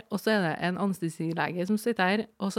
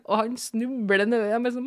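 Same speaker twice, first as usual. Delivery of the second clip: ordinary talk, nothing marked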